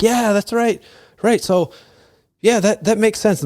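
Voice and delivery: high-pitched villagers' mock agreement; eager agreement. Retold villagers agreeing with the wise knight, high-pitched eager agreement.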